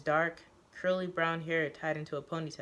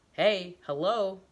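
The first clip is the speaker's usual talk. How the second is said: calling out; 3 semitones higher, 7 dB louder, slower than usual. Acted. Calling someone's attention loudly.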